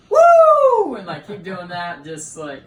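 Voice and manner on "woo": high, very loud wrestler's "Woo!"; imitated yell. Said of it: "going down the ring yelling".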